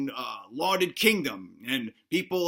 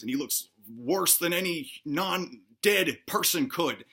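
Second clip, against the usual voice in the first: ordinary delivery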